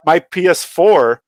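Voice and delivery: ordinary delivery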